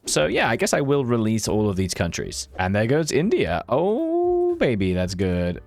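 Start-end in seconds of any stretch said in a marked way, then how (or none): 3.7-5.6 delighted oh. Real, not acted. Delighted at India falling.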